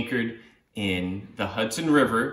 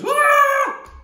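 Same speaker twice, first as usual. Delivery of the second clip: very high, loud cry; startled wail; 5 dB louder than usual. Acted. Boy startled by the guitar, high loud wail. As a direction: yelp in shock. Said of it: "the boy says".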